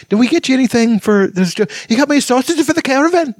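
put-on Scottish accent, very high and childlike; little Scottish girl. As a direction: act out a tiny excited Scottish girl. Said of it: "this little girl who said".

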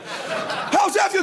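high, drawn-out excited shout; elated shouted question. Retold or imitated. Dad asking his son after birdie, elated shout. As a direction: stretch it out high in triumph.

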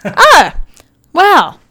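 loud, high-pitched mock surprise; mock disbelief. Teasing partner over his list, loud mock disbelief. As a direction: exclaim it in exaggerated disbelief. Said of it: "And I'm like".

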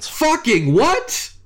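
high, stretched disbelief in one go; slow, high-pitched astonished question; incredulous. Real, not acted. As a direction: blurt it as a high rising question. Stunned by the limited edition news.

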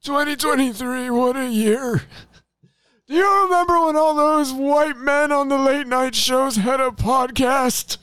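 high, frail-old-timer put-on voice; old and decrepit. Host pretending to reminisce decades later, old and decrepit. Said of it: "when we're all old and decrepit".

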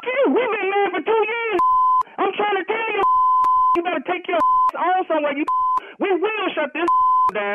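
high-pitched, indignant protest; real outrage. Real, not acted. Husband tells caller they've been married, outraged. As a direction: argue back, voice pitched right up.

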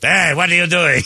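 put-on high French-accented elf waking a child. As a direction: startle a kid awake in a mock French accent. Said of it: "French accent".

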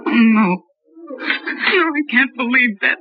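breaking into tears, a broken whispered no; a wife crying, told her husband lied about his pay. Acted. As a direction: sob out the denial. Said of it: "tears take time".

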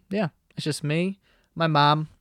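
ordinary talk

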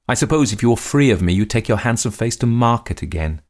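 high, loud, furious taunt; sudden fury. A narrator's reading. Lady lashing out at the man, furious. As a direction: flare up and taunt him. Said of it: "sudden flash of new fury".

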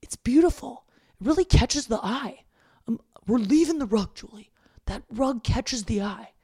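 doing her dad defending his rug; playful impression of a stubborn father; imitating dad. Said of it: "My dad's like".

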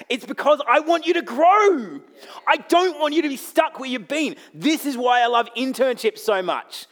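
high, loud, impassioned exhortation; full-force preaching. Real, not acted. Preacher urging the church to grow, loud and impassioned.

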